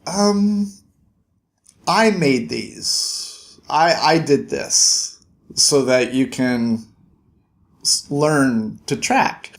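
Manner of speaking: slow, hesitant confession; halting, embarrassed mentor; sheepish Martin. Retold or imitated. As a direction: admit it awkwardly, trailing off. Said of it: "Martin's like".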